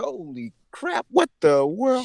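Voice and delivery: high-pitched acted bewilderment; startled disbelief. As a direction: react in comic shock to a sudden police order. Acted startled reaction to trooper's order.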